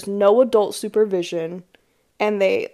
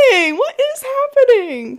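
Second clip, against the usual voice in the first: higher, bewildered exclamation; shocked; 6 dB louder than usual. Retold or imitated. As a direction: sound totally baffled. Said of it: "I'm like".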